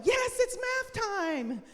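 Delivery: high, slow, overjoyed; eager delight. Shown as a demonstration. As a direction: sell it like math is the best treat. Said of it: "We're going".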